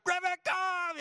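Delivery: high, loud yell for the dog; screaming call. Retold or imitated. Owner screaming for his runaway dog in the snow. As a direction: scream the dog's name across the snow. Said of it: "I'm screaming my head off".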